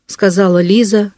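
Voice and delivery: plain reading voice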